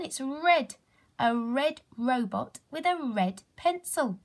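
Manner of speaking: usual speaking voice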